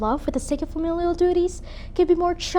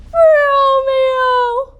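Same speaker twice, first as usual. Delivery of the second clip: high, loud, drawn-out cry; calling out; 13 semitones higher, 12 dB louder than usual. Acted. Recalling the name heard in the streets, calling out. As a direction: shout the name across the streets. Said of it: "called out".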